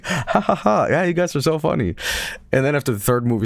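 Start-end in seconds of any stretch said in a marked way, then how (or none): 0.0-1.9 higher, hollow forced cheer; fake polite laugh. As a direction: pretend to find it funny. [name] faking laughter on set.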